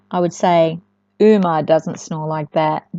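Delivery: ordinary delivery, nothing marked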